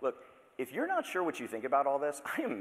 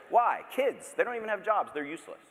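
mock outrage; 10 semitones higher, 5 dB louder than usual. Mock complaint about kids.

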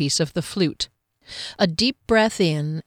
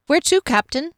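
higher-pitched lad asking his captain; eager young lad; 9 semitones higher than usual. A narrator's reading. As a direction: ask up high, like a helpful boy.

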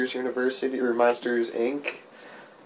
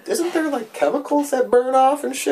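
skeptical disbelief; 13 semitones higher, 4 dB louder than usual. Retold or imitated. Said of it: "I'm like".

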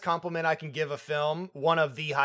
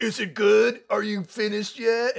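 slightly raised, mock-eager man's voice; imitated lover; 4 semitones higher, 3 dB louder than usual. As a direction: lightly imitate a man checking in mid-act. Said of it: "He's like".

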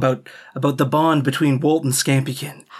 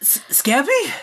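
lightly higher, bewildered voice; puzzled, halting questions; confused Walt; 10 semitones higher, slower than usual. Acted. Walt confused by transformed pet.

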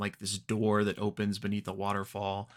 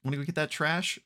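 much higher, sing-song call to a friend; mock-sweet invitation, pitched way up; playful call; 8 semitones higher than usual. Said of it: "and Ame is like".

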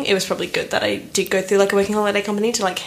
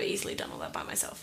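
whisper; 14 dB quieter than usual. Real, not acted. Quiet aside.

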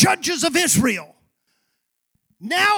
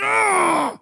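mock angry cry; 6 semitones higher than usual. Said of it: "have you ever been so mad".

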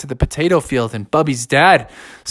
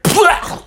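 spitting plah. Acted. Bear spits out slop.

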